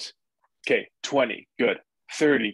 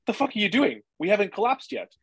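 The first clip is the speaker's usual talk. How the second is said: pitched-up, pushy inner voice; the body egging you on, voiced higher; body's voice; 9 semitones higher, faster than usual. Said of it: "Your body is like".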